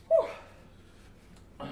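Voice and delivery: breathless high whew. Real, not acted. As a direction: blow out a sharp high whew. Reacting to hot sauce.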